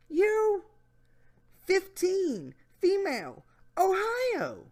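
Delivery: high put-on voice; Jeff Stacy voice. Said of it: "my Jeff Stacy voice".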